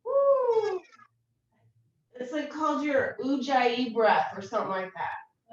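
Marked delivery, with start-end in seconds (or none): 0.0-0.8 high whoop. Acted. Yoga breath out, Ujjayi.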